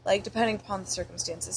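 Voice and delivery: normal talking voice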